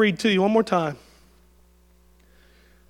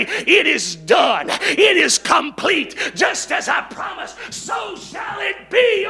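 loud, slow shouted declaration, climbing higher; proclaimed; 9 semitones higher, 6 dB louder, slower than usual. Retold or imitated. Pastor voicing God's final declaration, slow loud shout. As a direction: declare it like the voice from the throne. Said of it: "This time he's gonna say".

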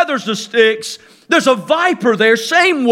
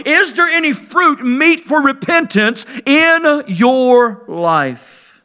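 preaching at full force; 4 semitones higher, slower than usual. Acted. Preacher to his congregation about the Pharisees, preaching at full force. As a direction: thunder it out like a fiery preacher.

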